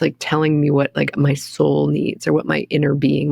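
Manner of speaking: normal talking voice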